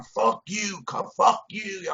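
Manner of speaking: high defiant cursing, slow and drawn out; retold yelling. Prisoner defying guards from his cell, high mock yell. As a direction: taunt them to come in. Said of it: "I'm yelling".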